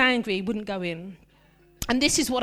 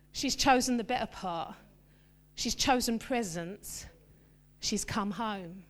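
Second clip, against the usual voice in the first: softer, slowing gentle reply; gentle answer; 4 semitones lower, 8 dB quieter than usual. Retold or imitated. Jesus gently answering Martha, tender. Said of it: "And Jesus says".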